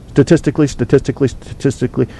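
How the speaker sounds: "statistically" rattled off three times in a row; quick tongue-twister repetition of "statistically"; three times fast. Shown as a demonstration. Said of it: "to say it three times fast".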